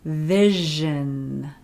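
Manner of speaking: "vision" with a buzzing "zh"; example word. Shown as a demonstration. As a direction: lean on the "zh" in "vision". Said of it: "So something like vision".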